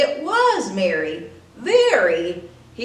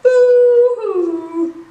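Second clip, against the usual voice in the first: high, loud mock crying boo-hoo; 11 semitones higher, 8 dB louder than usual. The Grinch mocks the crying Whos, loud, high and wailing. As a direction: jeer at their imagined tears. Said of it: "will all cry".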